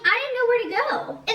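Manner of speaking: high-pitched defensive quote; retold defensive protest. Girl defending herself to friend, retold high-pitched protest. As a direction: plead your case high, retelling it.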